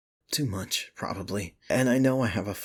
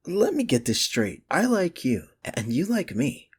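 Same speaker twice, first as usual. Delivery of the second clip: excited realization; 7 semitones higher, 5 dB louder than usual. Real, not acted. Realizing the feelings are mutual.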